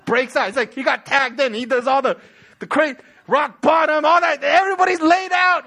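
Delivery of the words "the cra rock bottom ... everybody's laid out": loud, high play-by-play fervor; breathlessly piling up the action; worked-up excitement. Real, not acted. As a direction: shout the wrestling climax, building higher. Excited wrestling-style climax of sermon.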